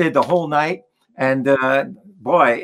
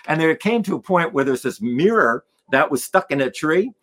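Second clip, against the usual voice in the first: ordinary delivery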